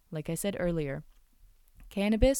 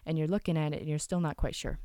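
usual speaking voice

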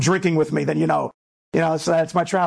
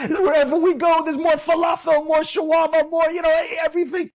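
excited soldier; 9 semitones higher than usual. Retold or imitated. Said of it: "that one soldier who was saying".

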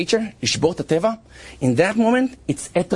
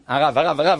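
usual speaking voice

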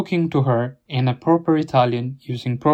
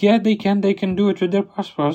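mimicking the clerk; 6 semitones higher than usual. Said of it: "It's like".

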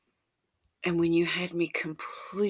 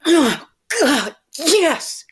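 loud, high, pleading cries; impassioned, pleading outcry; screaming with pleasure; 8 semitones higher, 9 dB louder than usual. Acted. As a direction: cry out high and loud, begging between moans. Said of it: "but now screaming with pleasure".

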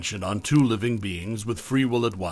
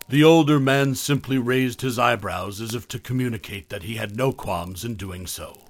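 ordinary delivery

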